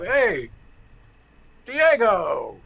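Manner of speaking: Hey Diego called up high and loud, pointed; calling out by name. Retold or imitated. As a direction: call him out, sure you've got him.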